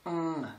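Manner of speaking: "Hum" as a soft, held nasal NG. Shown as a demonstration. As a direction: hum "Hum" low through the nose. (« Hum » : un ng nasal, doux et tenu)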